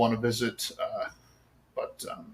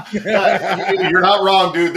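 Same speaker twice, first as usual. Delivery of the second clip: laughing; 5 semitones higher, 5 dB louder, much slower than usual. Real, not acted. Laughing reply to the eating joke.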